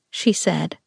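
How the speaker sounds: ordinary delivery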